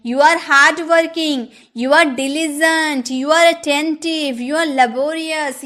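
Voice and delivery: very slow, each word spaced for copying; teacher dictating examples slowly; slow dictation. Shown as a demonstration. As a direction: read it out like dictation to a class. Teacher reads example sentences slowly.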